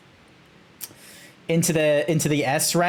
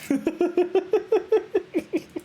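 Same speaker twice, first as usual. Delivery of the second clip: high, breathy giggle; high laugh; 13 semitones higher than usual. Real, not acted. Cracking up at the character's chest, high giggle.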